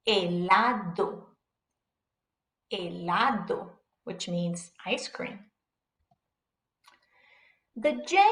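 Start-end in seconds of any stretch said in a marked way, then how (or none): 0.1-3.7 slow "Helado" with no H sound, starting on the E; silent H. Shown as a demonstration. Teacher models the word for Spanish learners, silent H. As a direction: say "Helado" as e-la-do, unhurried. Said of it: "The H is silent".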